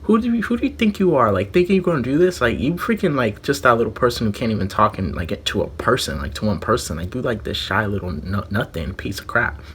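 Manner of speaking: sneering retold taunt; mocking sneer. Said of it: "the way she said it".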